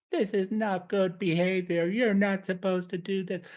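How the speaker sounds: high, put-on scolding voice like a disapproving parent; scolding partner. Partner one scolding the other at the pub, high and prim. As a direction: tell them off for going to the pub.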